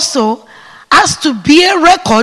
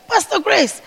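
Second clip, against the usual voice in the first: ordinary talk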